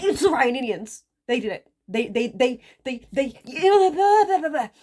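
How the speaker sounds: rising loud flustered babble; panicked blame. Retold or imitated. Father panicking, blaming others.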